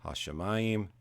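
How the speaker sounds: "hashemayim" stressed on MA, the next to last syllable; penultimate stress. Shown as a demonstration. Teacher demonstrating the accent to students, "hashemayim" with stress on next-to-last MA. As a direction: lean on MA in "hashemayim", then fall away. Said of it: "they both have an accent on the next to last syllable".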